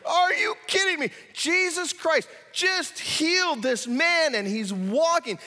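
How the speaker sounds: high, loud disbelieving cry; amazed crowd. Retold or imitated. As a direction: gasp it out in disbelief, then cheer. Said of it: "Everybody in the room's like".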